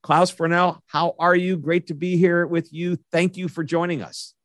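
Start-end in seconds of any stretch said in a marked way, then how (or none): none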